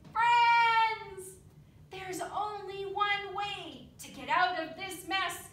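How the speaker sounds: loud high rallying shout; rally the crowd with a shout. A narrator's reading. Dilly shouting to her friends, loud and high. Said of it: "thought quickly and shouted".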